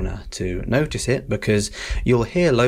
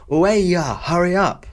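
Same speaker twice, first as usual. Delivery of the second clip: high, loud, impatient hurry-up call; impatient urging; 9 semitones higher, 4 dB louder than usual. Shown as a demonstration. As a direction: push a late friend to hurry. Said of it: "you can go".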